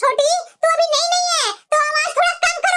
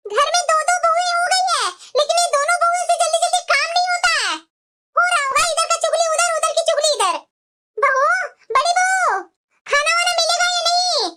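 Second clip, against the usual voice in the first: put-on higher, nagging old-woman voice; scolding mother-in-law; 4 semitones higher than usual. Mother-in-law complaining to her daughters-in-law about late food, nagging. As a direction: grumble like a bossy saas.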